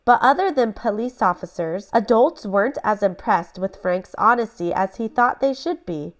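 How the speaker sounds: plain reading voice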